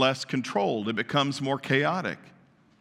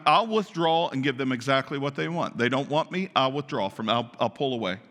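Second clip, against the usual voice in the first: ordinary talk, nothing marked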